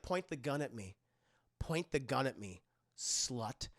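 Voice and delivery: hushed, low voice; low hushed plea. Retold or imitated. Gollum Girl begging.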